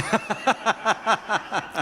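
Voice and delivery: high laughter trailing off. Real, not acted. Laughing at the girl calling him creepy, high laugh. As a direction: laugh it out and let it fall.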